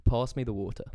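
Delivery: English accent, long AH in "Pass"; British "water" with a crisp T. Shown as a demonstration. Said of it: "just compare that".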